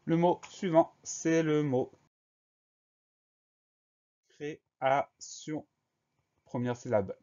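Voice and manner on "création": very slow, separated syllables, raised pitch; syllable-by-syllable dictation. Shown as a demonstration. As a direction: dictate "création" slowly as cré, a, sion. Dictée d'un mot par syllabes. (dictée très lente, syllabe par syllabe)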